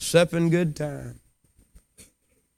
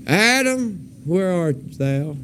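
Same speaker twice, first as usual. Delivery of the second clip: a searching call, dropping back on the question; God calling Adam; 3 semitones higher, much slower than usual. Acted. Preacher voices God seeking a sinner, calling out. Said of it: "Maybe the voice of God saying".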